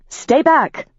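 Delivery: high urgent command; sharp warning. Acted. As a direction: warn him off, sharp and high. Rachel warns off the demon.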